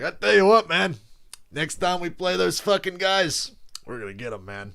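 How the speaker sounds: loud, high fired-up coach impression, easing off at the end; hammed-up coach vowing payback; John Gruden. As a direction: burst out emphatically, then drop to a confiding finish. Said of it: "Gruden's like".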